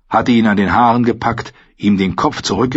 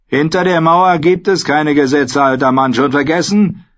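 raised, heated jeer; defiant retort; 7 semitones higher, 4 dB louder than usual. A narrator's reading. Mutineer answers the Lord Commander, defiant taunt. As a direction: shout down the old man's scruples.

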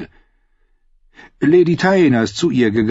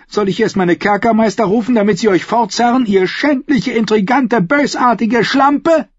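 rising fury; 10 semitones higher, 4 dB louder than usual. Acted. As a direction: spit each insult higher until the last. Margeri insults Cersei, furious. (wütend, immer höher bis zur Beleidigung)

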